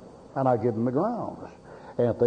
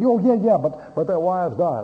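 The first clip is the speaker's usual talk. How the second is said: higher, louder hurried protest; mock flustered excuse; 6 semitones higher, 5 dB louder than usual. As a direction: play the cornered man backpedaling. Preacher retells man's excuse.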